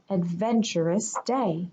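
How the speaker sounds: plain reading voice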